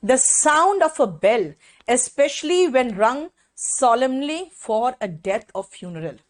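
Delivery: very slow, word-by-word dictation of the definition. Shown as a demonstration. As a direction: dictate it so students can write. (धीमे-धीमे लिखवाना)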